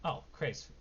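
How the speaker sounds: ordinary delivery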